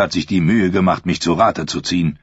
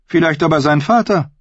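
high, cold, haughty queen; evil queen; 9 semitones higher than usual. Acted. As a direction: sneer down at him as queen. Cersei voiced in reading.

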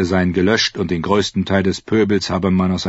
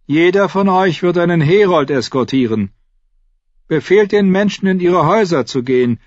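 higher, lightly voiced dwarf lord; Tyrion's voice; 11 semitones higher, 6 dB louder than usual. Tyrion answers the Hound about the horse, higher voice. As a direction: pitch up into Tyrion's quick voice.

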